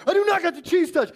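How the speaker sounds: put-on high, loud, quick kid's protest; hammed-up indignant playground retort; mock indignant denial. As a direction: fire back like an offended kid at tag. Acts kid denying in tag game.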